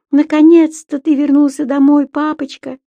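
high loud creature voice; Субастик. A narrator's reading. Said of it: "сонно пробормотал тот". (высокий голосок Субастика)

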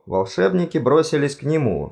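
ordinary delivery

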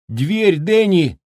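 put-on high sharp call to a child; urgent cry. A narrator's reading. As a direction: cry out in alarm. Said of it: "крикнула она".

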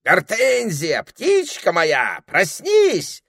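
high, loud wake-up call; крик. A narrator's reading. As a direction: shout slowly to rouse a sleeper. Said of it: "закричал он". (громкий протяжный крик)

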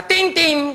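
high ringing "tin, tin"; doorbell imitation. Storyteller's doorbell rings the next day, doorbell imitation. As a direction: ring it like a doorbell.

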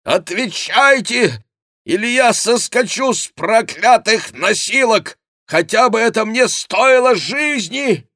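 high, loud, sustained yelling; ringing, furious-sounding demand; shouted threat. A narrator's reading. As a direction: shout a desperate ultimatum at full voice. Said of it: "кричал голос".

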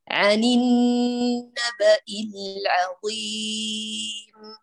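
very slow, clear Quranic recitation; measured recitation. Shown as a demonstration. Teacher models correct recitation for her students. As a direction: recite each letter distinctly and slowly. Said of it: "بهذا الشكل".

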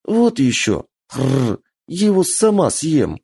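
put-on high, cocky child with growl; defiant little girl. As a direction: brave it out playfully.

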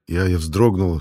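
ordinary delivery

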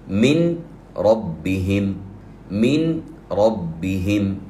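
مِن ربهم with the nun blended into the ra; slow demo of من ربهم with no nun heard; merged nun. Shown as a demonstration. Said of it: "كيف تكون القراءة؟".

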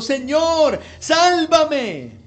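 loud, high shout for help; crying out. A narrator's reading. Peter sinking in the sea calls to Jesus, crying out. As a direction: shout it as a desperate dramatized plea. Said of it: "diendo voces".

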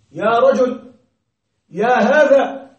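loud, drawn-out vocative call. Shown as a demonstration. Teacher demonstrating the vocative to students, calling out. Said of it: "كذلك من علامات الأسماء النداء".

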